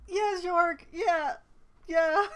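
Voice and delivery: loud, mocking yeah; mock loud yes. Streamer mocking the game character, loud. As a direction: bark it back at the game.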